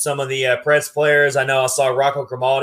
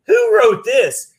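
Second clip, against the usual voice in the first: disbelief; 9 semitones higher, 5 dB louder, slower than usual. Retold or imitated. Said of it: "And I'm like".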